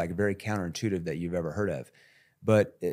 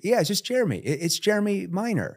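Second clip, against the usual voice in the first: much higher, familiar cold-call opener; raised voice; 11 semitones higher than usual. Shown as a demonstration. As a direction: pitch up to sound like an old friend. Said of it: "I raised my voice intentionally".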